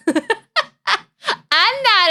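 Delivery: high, slow, breaking into laughter; giggling real laugh through the words. Real, not acted. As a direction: deny it while cracking up. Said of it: "laughing denial".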